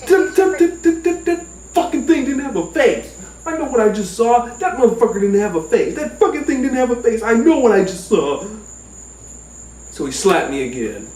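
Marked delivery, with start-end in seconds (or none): 0.1-8.4 high stammer into frantic repeated protest; panicked insistence. Retold or imitated. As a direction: insist hysterically, easing slightly in pitch. He insists dog had no face.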